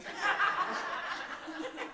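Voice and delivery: crowd laughing at the joke; audience laughter. Real, not acted. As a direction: let the room laugh.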